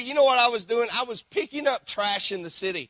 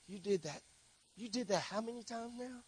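muttered reproach; 2 semitones higher, 16 dB quieter than usual. Acted. His own judging inner voice.

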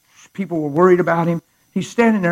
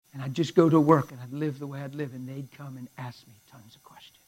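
ordinary talk

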